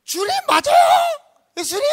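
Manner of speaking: high, very loud frantic call; yelling. Acted. Peter calling to Jesus from the boat, high yell. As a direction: scream the question across the water. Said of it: "소리를 지르는 거야".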